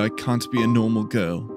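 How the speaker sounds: usual speaking voice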